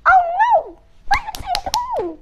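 high, loud panicked cry; frightened donkey voice, high. Acted. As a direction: squeal it in alarm. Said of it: "he thought".

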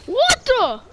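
high loud "what the"; startled. Real, not acted. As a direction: caught off guard, shouting. Sudden attack in game.

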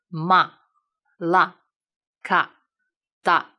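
"ma、la、ka、da" 逐个念，中间留停顿; 像报听写一样一个一个慢慢念; 听写式慢读. Shown as a demonstration. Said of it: "因为我读的不是很快". (一字一顿地慢读)

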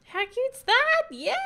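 high, loud squeal; delighted squeal. Real, not acted. Happy with her wedding lights, squealing. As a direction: cheer at how cute it looks.

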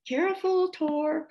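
very high, loud warning call; mother alligator calling. A narrator's reading. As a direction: call out a sing-out warning to a mate. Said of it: "called the mother alligator".

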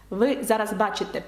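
ordinary talk, nothing marked

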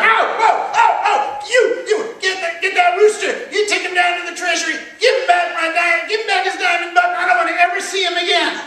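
very high, loud frantic yelping; hammed-up high-pitched panic; stung, panicked Sultan. A narrator's reading. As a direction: yelp in pain and shriek out hurried orders. Sultan stung by bees, yelling.

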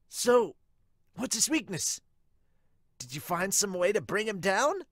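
put-on high, loud eager boy voice; loud cheerful kid bursting into the room; excitable Sawamura. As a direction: burst in bright and keen as the hyper rookie. Sawamura pushes for secrets.

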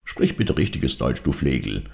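usual speaking voice

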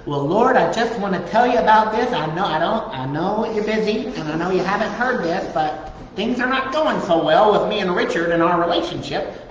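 hammed-up fretful petition to God; mock whiny prayer. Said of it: "why in the name of Sam Hill would you speak that way".

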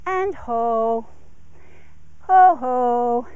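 "ho" said with a falling tune, drawn out; downward inflection. Shown as a demonstration. Trainer easing the horse down to walk, falling "ho". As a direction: let each "ho" drop in pitch to settle him. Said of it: "my downward transitions have a downward inflection".

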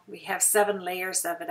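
ordinary talk, nothing marked

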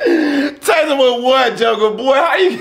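loud, high, slow, crying with laughter; real laughing squeal; laughing disbelief. Real, not acted. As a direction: squeal through laughter at the absurdity.

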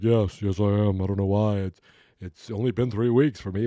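a hint of a put-on kid voice; kid's reply. Voicing the kid Jules or Vern.

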